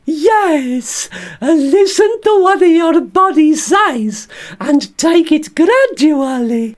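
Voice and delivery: very high, slow squeaky puppet voice playing the surgeon; Bert the stoma bag as the surgeon. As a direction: squeak it out like a little puppet doctor. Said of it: "can you be the surgeon for me".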